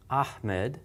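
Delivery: "Ahmed" with a breathy, non-grating h before the m; pure air on the h of "Ahmed"; hard H. Shown as a demonstration. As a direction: softly say "Ahmed", tight-throated airy h in the middle. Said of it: "it's got a hard H sound".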